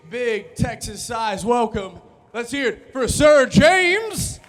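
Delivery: slow, loud hype call; arena announcer. Acted. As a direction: drag it out for the crowd. Rodeo-style crowd hype.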